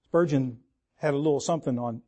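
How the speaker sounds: usual speaking voice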